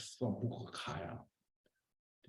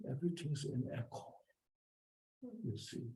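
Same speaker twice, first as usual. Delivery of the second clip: usual speaking voice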